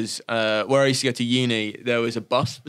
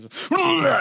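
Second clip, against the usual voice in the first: sudden high mock vomit noise; mock retching. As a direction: imitate throwing up mid-sentence. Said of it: "just immediately vom".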